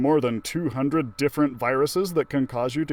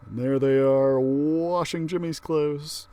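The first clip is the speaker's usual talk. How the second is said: ordinary delivery, nothing marked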